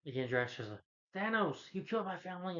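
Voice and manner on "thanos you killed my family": imitating an enraged cry, pitched much higher; hammed-up outburst, voice jumping up; mock angry cry. Drax accusing Thanos, a higher mock angry cry.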